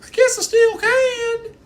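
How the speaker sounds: very high slow squeaky voice; high pitch. Pretend. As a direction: keep squealing in falsetto. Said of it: "I wish I could go high pitch".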